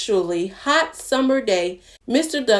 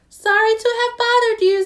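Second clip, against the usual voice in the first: much higher, polite chirp; high chick apology; 12 semitones higher, 3 dB louder, faster than usual. Acted. The chick apologizes to the duck in a high voice. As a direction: chirp a little sorry. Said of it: "said Chick".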